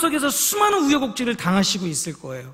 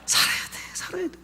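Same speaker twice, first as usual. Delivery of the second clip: 숨죽여 흐느끼며 조용히 애원; 목이 메어 점점 잦아드는 울음 섞인 부탁; tearful plea; 5 dB quieter than usual. Retold or imitated. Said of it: "막 엄청 울었어요". (꿈속 아들에게 흐느끼며 속삭이듯 애원하는 아빠)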